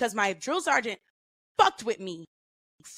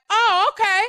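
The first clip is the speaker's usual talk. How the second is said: much higher, louder startled okay; taken aback; 11 semitones higher, 7 dB louder than usual. Retold or imitated. Drill sergeant startled by the private's bold honesty. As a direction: catch yourself, caught off guard. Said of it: "he gathered himself real quick and was like".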